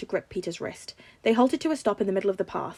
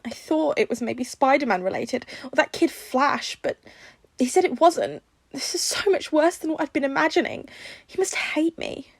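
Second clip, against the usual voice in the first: high, anxious, lightly upset; aunt fearing her nephew hates her; mildly tearful worry; 6 semitones higher, 4 dB louder than usual. A narrator's reading. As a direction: fret through the tears. May crying to Tony.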